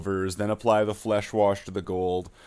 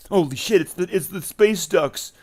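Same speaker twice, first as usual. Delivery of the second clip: mock excitement; 8 semitones higher, slower than usual. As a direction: announce it like a thrilled fan. Excited reveal of space ducks.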